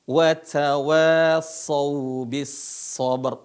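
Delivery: "wa tawasau bis sabr" slow, with a heavy final r; slow recitation. Shown as a demonstration. Teacher reciting for students to repeat. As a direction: stretch each word, close on "sabr".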